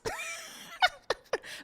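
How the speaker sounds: squealing laugh, very high; high burst of laughter. Real, not acted. Laughing at her own shock over the blind guide. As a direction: crack up at the absurdity.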